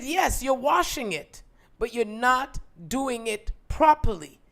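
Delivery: loud, slow, firm lecture; mother lecturing her daughter at the tub, loud and slow; mother laying down the law. Retold or imitated. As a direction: drill every word home to your child. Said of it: "she says".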